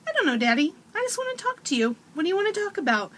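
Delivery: put-on high, quick little-boy voice; little boy's chatter. Son chatting with dad at bedtime, high and quick childish voice. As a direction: chirp it like a four-year-old stalling bedtime.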